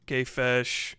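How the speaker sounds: usual speaking voice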